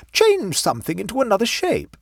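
high-pitched coaxing request; pleading. A narrator's reading. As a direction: coax him in a high, eager voice. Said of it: "she pleaded".

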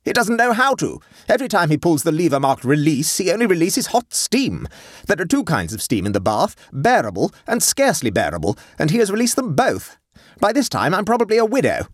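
high-pitched, emphatic urgency; fearful intensity. A narrator's reading. Wife describing husband trapped in steam bath, fearful intensity. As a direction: spell out the calamity intensely. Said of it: "speaking with fearful intensity".

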